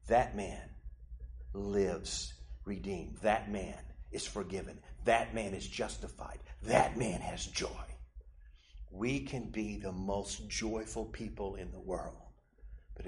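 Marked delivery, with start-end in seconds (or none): 0.1-7.9 lower, soft, slow and tender; gentle Jesus. Acted. Jesus declaring the humble man forgiven, soft and slow. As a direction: lay each phrase down like a blessing. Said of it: "And Jesus said".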